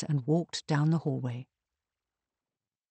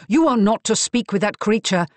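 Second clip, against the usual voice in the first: sharp angry snap, louder; snapped; 4 semitones higher, 6 dB louder than usual. A narrator's reading. Brother snaps at his sister, angry and loud. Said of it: "snapped Marcus".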